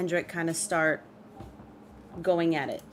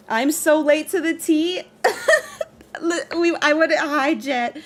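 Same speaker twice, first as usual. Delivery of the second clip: louder and higher, laughing through it; excited laughing; 8 semitones higher, 6 dB louder than usual. Real, not acted. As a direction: burst into laughs greeting a viewer. Notices viewer Jet, laughs.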